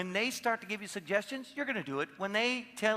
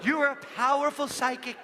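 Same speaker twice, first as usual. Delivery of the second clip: higher, louder, hammed-up fortune-teller voice; mock mystic; 7 semitones higher, 5 dB louder than usual. Shown as a demonstration. Coach demoing what to tell a neighbor, mock mystic. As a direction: proclaim it like a stage psychic. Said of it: "just do this".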